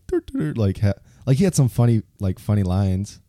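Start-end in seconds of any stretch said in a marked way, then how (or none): 0.1-0.6 dopey clown voice. Retold or imitated. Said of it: "like the clown".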